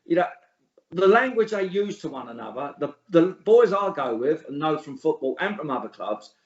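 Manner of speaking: ordinary delivery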